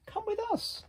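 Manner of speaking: squeaky high little animal voice; high character voice. Acted. Little animals inviting the turtle aboard, squeaky high voice.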